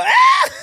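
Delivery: high loud mock scream; neighbor's loud scream; terrified scream. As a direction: scream at the dog. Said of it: "you even scream that".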